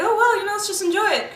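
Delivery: high, loud and laughing; gleeful giving in. Retold or imitated. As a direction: throw up your hands and give in with a laugh. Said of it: "So I was like".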